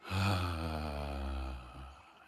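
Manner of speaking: long audible sigh out; voiced sigh. Shown as a demonstration. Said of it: "letting your voice glide out of you".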